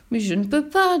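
high-pitched, acted pleading; distressed protest. A narrator's reading. Giant protests he cannot wait.

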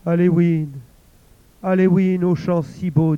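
put-on old woman mourning over the head, loud lament; weeping lament. A narrator's reading. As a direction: cry his name in grief. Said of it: "se mit à pleurer".

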